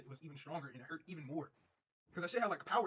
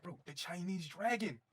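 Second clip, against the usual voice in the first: drawn-out naming; 3 semitones higher, much slower than usual. Retold or imitated. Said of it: "So I was like".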